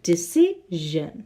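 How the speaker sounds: "decision" drawn out, ending in a buzzing zh. Shown as a demonstration. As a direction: say "decision" slowly, setting off the final "jeune" sound. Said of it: "or jeune, like".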